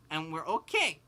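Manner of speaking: ordinary talk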